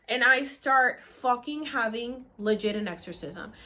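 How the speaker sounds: normal talking voice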